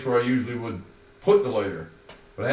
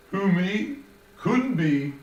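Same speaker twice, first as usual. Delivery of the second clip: higher, louder sing-song denial; mock innocent denial; 10 semitones higher, 5 dB louder, much slower than usual. Shown as a demonstration. Narrator showing Joey the song lines, mock innocent. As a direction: play innocent.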